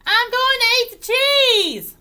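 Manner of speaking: put-on high, loud child's shout; gleeful high-pitched holler; called out. A narrator's reading. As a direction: announce it at the top of your lungs. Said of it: "called out the child".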